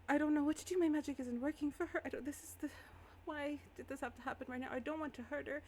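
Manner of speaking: put-on high, flustered young spellcaster; Iris in distress. Game master voices distressed, helpless Iris.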